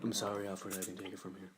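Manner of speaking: low, flat monotone robot-like voice; deadpan superhero with no expression; Cyborg impression. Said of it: "my cyborg impression".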